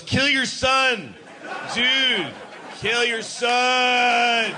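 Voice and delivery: high, slow commanding shout; God commanding. Retold or imitated. God ordering Abraham, slow high shout. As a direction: drag out the order like a bellowing deity. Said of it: "God goes".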